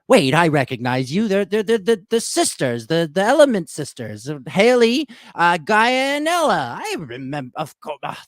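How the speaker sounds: high, loud, stammering recognition; an old woman excitedly hunting for names; excited old grandmother. Acted. Game master voices excited Grandma Willow.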